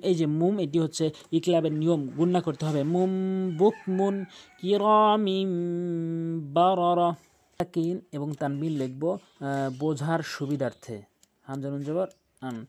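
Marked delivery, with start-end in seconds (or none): 2.9-7.1 slow drawn-out tajweed chant; slow measured recitation with nasal ghunnah; Quranic recitation. Acted. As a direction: recite each word long and melodic.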